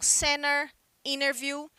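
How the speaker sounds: center, interview with the T almost dropped; American English silent T; American pronunciation. Shown as a demonstration. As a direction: swallow the T, American style. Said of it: "esse T, ele quase desaparece".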